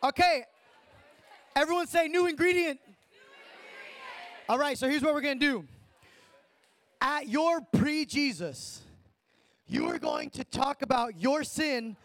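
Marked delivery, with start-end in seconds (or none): 4.5-12.0 hoarse, worn out from screaming; lost voice. Real, not acted. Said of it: "I have no voice from screaming every day".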